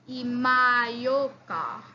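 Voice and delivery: Imayoka said slowly, drawn out with a rise; teacher modelling Imayoka slowly; slow model word. Shown as a demonstration. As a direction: stretch out Imayoka syllable by syllable. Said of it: "please pause the video, write it down".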